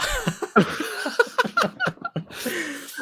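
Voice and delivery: drawn-out high cackle; long high laugh. Real, not acted. Laughs at worst game.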